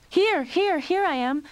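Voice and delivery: very high, loud eager reply; calling back. A narrator's reading. Lionel answering his mother's call, loud.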